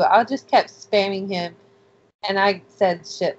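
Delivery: ordinary delivery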